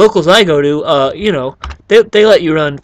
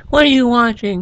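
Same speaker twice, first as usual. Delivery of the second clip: mock mom, pitched up and puzzled; imitating a mom; 8 semitones higher than usual. Gamer mimics a viewer's mom asking what's on. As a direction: play the confused parent walking in. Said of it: "I don't want your moms to be like".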